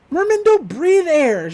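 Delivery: high, loud, drawn-out mock protest; exasperated objection. Boy objects to giving a merman CPR, mock exasperation. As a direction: protest it slowly, pitched right up.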